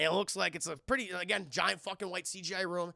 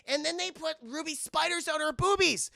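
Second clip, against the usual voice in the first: high, louder outraged quip; indignant exclaim; 9 semitones higher, 4 dB louder than usual. Retold or imitated. Streamer retelling the spider scene, high and loud. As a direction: push it up in mock outrage.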